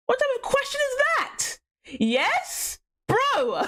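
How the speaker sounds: high, squeaky disbelief; incredulous. Real, not acted. Streamer answering a chat question, high incredulous squeal. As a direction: squeal it in outrage.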